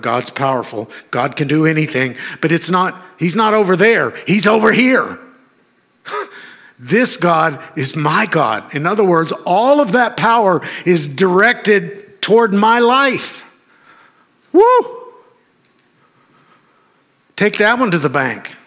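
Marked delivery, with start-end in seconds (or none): 3.2-5.2 raised, higher-pitched preacher's insistence; fervent preaching. Real, not acted. Preacher telling the congregation God is near, fervently. 6.8-13.4 slow, high-pitched triumphant proclamation; fervent preaching. Real, not acted. Preacher claiming God's power for his life, fervently. 14.5-15.1 high, loud "woo"; exultant whoop. Real, not acted. Preacher exulting after his proclamation, whooping.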